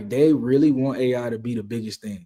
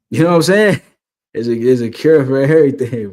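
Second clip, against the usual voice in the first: louder, insisting on everything; emphatic loud; 8 dB louder than usual. Real, not acted. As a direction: push it out like a firm claim. Insisting loudly on the cure.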